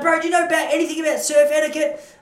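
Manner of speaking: high, loud scolding bro; angry surfer bro. Retold or imitated. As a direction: bark it at a beginner. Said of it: "It's like".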